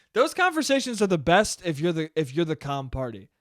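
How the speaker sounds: usual speaking voice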